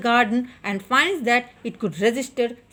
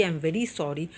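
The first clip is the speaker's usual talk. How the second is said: softer, lower repeat of the apology; quiet apology; 6 semitones lower, 6 dB quieter than usual. Retold or imitated. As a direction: drop the voice on the repeat. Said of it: "asking forgiveness".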